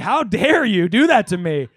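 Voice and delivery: put-on play-offended accusation at the girlfriend; mock outrage.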